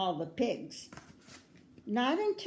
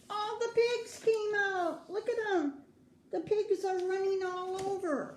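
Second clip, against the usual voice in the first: excited; 8 semitones higher than usual. Acted. Reader voices excitement at loose pigs.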